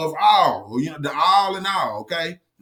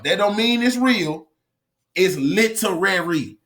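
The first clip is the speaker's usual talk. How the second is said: normal talking voice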